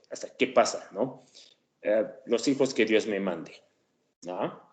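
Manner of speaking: ordinary talk, nothing marked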